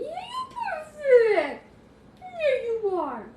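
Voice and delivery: high, drawn-out coo. Real, not acted. Owner praising the perfect puppy, slow high cooing. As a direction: stretch the words out in a high pet-praising voice.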